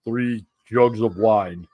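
lumber through it like a huge wrestler; Andre the Giant. Pretend. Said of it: "It's called Andre the Giant".

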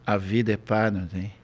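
low-pitched stoner musing; mock-profound low mumble of a stoner; stoned philosopher. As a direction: imitate a stoned teen being deep. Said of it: "Quando ele tá na chapaceira, ele é filosofa".

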